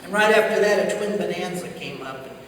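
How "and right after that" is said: ordinary delivery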